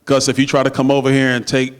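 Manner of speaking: ordinary delivery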